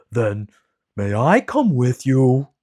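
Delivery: slow, loud, timid big lion voice; a shy king of the beasts; cowardly lion. Acted. Lion character voice.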